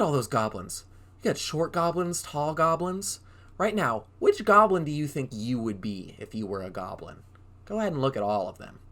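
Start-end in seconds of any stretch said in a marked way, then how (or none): none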